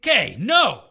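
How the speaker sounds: usual speaking voice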